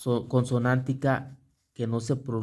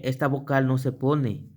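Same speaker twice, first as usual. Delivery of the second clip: ordinary delivery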